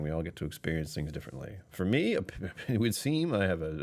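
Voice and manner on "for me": usual speaking voice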